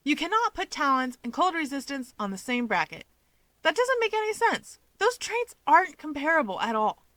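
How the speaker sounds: high, indignant, emphatic objection; emphatic protest. Acted. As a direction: argue it with flailing conviction. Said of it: "Keigo gestures emphatically with his hands".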